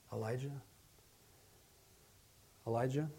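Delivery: soft, gentle call by name; hushed questioning call; still small voice. Acted. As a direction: call the name very quietly, leaving space after it. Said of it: "in a still, small voice".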